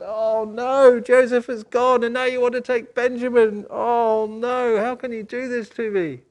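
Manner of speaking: loud, high, anguished lament; wailing father. Acted. Father lamenting to his sons about Benjamin, loud and anguished. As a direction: cry out in grief at losing another son.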